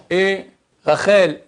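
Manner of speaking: ordinary talk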